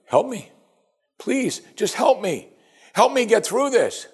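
high, slow pleading; desperate plea. Retold or imitated. Preacher voicing a suffering believer begging the Lord, high and slow. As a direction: beg slowly, voice lifted. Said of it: "what do you say to the Lord".

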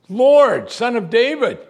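high, loud acted cry; yelling out. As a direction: call out insistently, pitch way up. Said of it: "she's yelling at him".

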